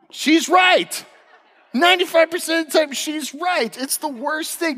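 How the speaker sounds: high-pitched exasperated admission; comic exasperation. Real, not acted. Husband admitting his wife is right, comically exasperated. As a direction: squeal it out in mock outrage.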